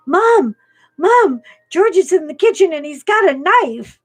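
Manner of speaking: put-on high, loud frantic child calling mom; a son shouting the dog has a knife; panicked kid. Son runs in yelling to mom, frantic high voice.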